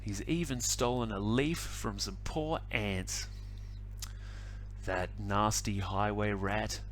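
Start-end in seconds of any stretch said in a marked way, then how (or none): none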